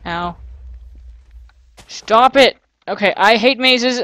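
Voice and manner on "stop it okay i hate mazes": loud, exasperated protest; raised, annoyed voice; fed-up outburst. Real, not acted. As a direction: snap loudly, at the end of your patience. Hurt and fed up in maze.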